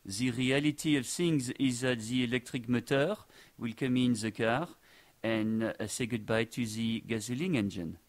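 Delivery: put-on slow, deliberate French accent. Host showing off his French accent on the electric motor verdict, French accent. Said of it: "that was my attempt at having a French accent".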